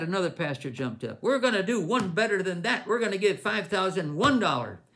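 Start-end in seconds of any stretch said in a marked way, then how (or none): 1.2-4.8 higher and louder, eager to outbid; excited pledge. Retold or imitated. Pastor jumping up at the luncheon to outbid, excited loud pledge. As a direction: jump in and top the last offer, loud and high.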